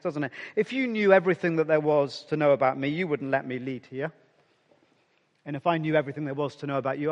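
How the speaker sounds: ordinary talk, nothing marked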